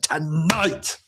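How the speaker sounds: mock-commanding manager calling for order; commanding declaration. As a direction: declare it like a boss silencing the room. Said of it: "I hope you slammed a hand on the table".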